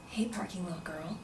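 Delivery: hushed, whispery come-on; creepy greeting. Retold or imitated. Jazz guy grabbing her from behind, creepily hushed. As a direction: imitate a creep in a soft whisper.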